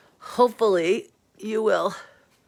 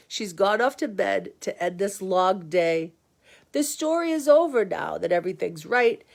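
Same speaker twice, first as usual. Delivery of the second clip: ordinary talk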